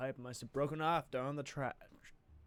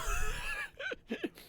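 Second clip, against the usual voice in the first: big laugh; 3 dB louder than usual. Acted. Character laughs after joke.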